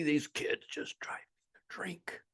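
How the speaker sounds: slow exasperated whisper; whispered complaint. Retold or imitated. Fed-up owner complaining about staff, whispered. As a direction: breathe it out like a defeated owner.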